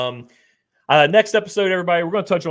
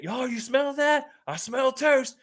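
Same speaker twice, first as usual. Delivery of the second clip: taunting heckle; 11 semitones higher, slower than usual. Retold or imitated. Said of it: "He starts saying".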